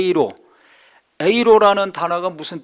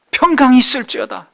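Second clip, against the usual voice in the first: loud, high proclamation of peace; solemn proclaiming blessing; 7 semitones higher, 8 dB louder than usual. Acted. Jesus blessing the trembling disciples, loud solemn proclamation. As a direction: ring it out like a blessing.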